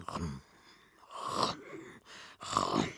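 low, soft "rum" with long gaps; imitated noise. A narrator's reading. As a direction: voice the cloth unrolling, low and spaced out. Imitated noise of fabric being measured.

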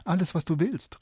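eager call. A narrator's reading. Princess eagerly promising the frog anything, high eager call. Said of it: "rief die Königstochter".

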